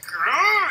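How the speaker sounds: mock growl. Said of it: "he's like".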